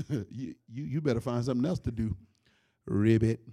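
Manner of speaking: low, tough little frog warning the snake; cocky frog voice. Acted. Comedian voicing the frog warning the snake, low and cocky. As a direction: drop way down and threaten like a fearless frog. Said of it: "The frog just went".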